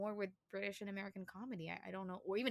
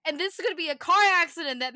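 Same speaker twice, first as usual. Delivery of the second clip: chirpy newscaster; 9 semitones higher, 14 dB louder than usual. Shown as a demonstration. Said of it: "it's like".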